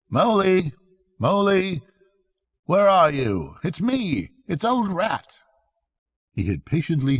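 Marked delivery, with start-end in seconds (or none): 0.1-5.4 cheerful calling. Acted. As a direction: keep calling out brightly for him. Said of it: "calling out cheerfully".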